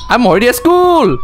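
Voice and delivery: high-pitched startled exclamation; excited surprise. Real, not acted. Player is startled the run ended at school, excited surprise. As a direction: blurt it high, caught off guard. Startled by how fast he arrived.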